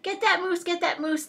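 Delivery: storybook hunter rallying the others; calling out. A narrator's reading. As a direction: call the order out, pitched high. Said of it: "Thidwick heard a voice call".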